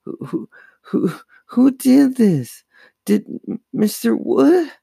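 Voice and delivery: very high, panicky stutter; terrified stammer. A narrator's reading. Chris terrified by the dummy, high frightened stammer. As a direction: stutter the first words, then blurt it high. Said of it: "expression taut with terror".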